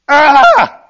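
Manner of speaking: sudden loud high yelp for help; a drowning man's sharp cry; desperate cry. Shown as a demonstration. Drowning swimmer crying out to the lifeguard, a desperate yelp. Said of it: "A, a cry of desperation".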